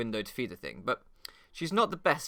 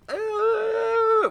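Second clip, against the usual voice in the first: high squeaky gasp of fright; mock frightened squeak; 7 dB louder than usual. Girlfriend frightened by a giraffe, high squeaky gasp. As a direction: imitate a startled girlfriend's squeak. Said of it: "she just fucking goes".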